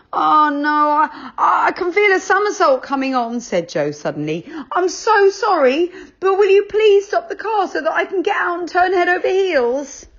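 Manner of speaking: put-on high, loud, flustered boy; a boy in a panic apologising; Joe, high and anxious. A narrator's reading.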